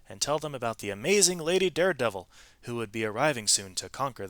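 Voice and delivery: lively read-aloud storytelling voice; expressive storybook narration; story narration. A narrator's reading. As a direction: tell it like a picture book to children. Reads a picture-book story aloud.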